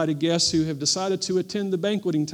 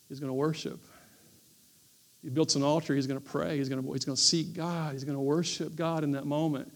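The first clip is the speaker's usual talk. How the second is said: much softer, low and intimate; quiet reflective preaching; 3 semitones lower, 8 dB quieter than usual. Real, not acted. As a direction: draw them in with a hushed voice.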